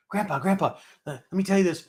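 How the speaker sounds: put-on excited little-kid voice; excited grandson. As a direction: imitate a child pestering Grandpa with a joke. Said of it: "my grandson comes in".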